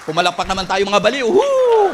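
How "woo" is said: loud high whoop; cheer. Real, not acted. Preacher cheering the congregation, loud whoop. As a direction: whoop to fire up the crowd. Pastor hyping crowd.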